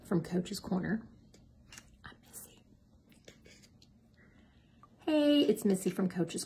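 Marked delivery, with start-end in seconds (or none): 2.0-2.6 soft breathy whisper. Real, not acted. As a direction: whisper your name. Quiet aside before intro.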